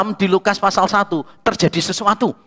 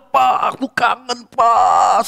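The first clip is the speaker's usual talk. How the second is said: imitated tearful greeting; 3 semitones higher, slower than usual. Former student hugging his teacher, imitated tearful greeting. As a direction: imitate an emotional cry of missing someone. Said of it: "Sambil nuangin gitu kan ya".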